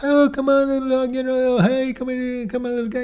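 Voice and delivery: high, cooing doggy talk; sweet sing-song pet voice. Acted. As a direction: coo at your little dog, very high. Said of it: "of course you talk to your dogs".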